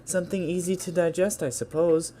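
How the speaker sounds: Yuri. Acted. Shy girl explains her first poem, high gentle voice.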